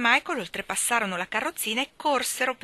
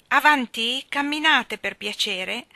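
high, loud nanny's order; loud, lively chivvying; brisk command; 6 semitones higher, 8 dB louder, slower than usual. A narrator's reading. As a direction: urge the children along firmly. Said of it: "disse Mary Poppins vivacemente".

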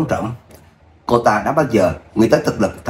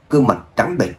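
ordinary delivery, nothing marked

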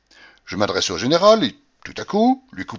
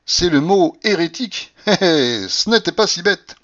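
high, louder, with a little chuckle; smiling, amused; 8 semitones higher, 5 dB louder than usual. A narrator's reading. Petit Français comments on the ruse, amused. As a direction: tease with a smile and a "hé, hé". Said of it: "remarqua le petit Français en souriant".

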